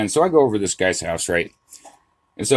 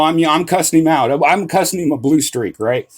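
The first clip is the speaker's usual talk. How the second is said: normal talking voice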